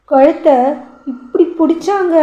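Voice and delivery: put-on small child voice. A narrator's reading. Child reporting, high voice.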